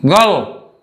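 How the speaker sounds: put-on teacher pointing out girl; stress on girl. Shown as a demonstration. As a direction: land hard on girl. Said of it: "we want to focus the word girl".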